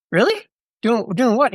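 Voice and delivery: high, incredulous "really?" jumping up; retold surprise. Telling the podcast how he reacted to the buddy, amazed. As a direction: reenact the shock of not knowing. Retelling his surprise at Pete.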